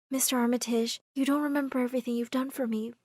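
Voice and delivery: soft, sorrowful thanks; subdued goodbye. Acted. Amara bidding her benefactor farewell, soft and sad.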